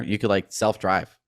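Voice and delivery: ordinary talk, nothing marked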